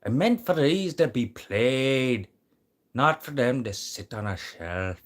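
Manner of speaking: high, loud, drawn-out Irish accent. Shown as a demonstration. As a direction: put on a big, slow Irish brogue. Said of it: "say that in an Irish accent".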